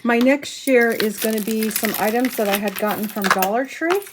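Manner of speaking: ordinary talk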